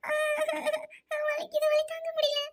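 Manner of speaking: high, moaning cries of pain; labour pain. Acted. Patient in labour pleads with the doctor, in pain. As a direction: wail weakly through a contraction.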